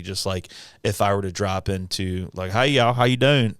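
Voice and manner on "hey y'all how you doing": Southern accent. Shown as a demonstration. Host showing how easily he slips into regional voices, Southern accent. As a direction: drop casually into a Southern accent. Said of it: "get into a Southern accent".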